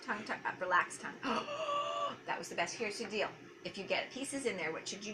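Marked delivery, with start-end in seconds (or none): 1.2-2.2 sharp high gasp of delight. Real, not acted. Therapist thrilled at the child's success, high gasp. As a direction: gasp like you just saw magic. Child does something great.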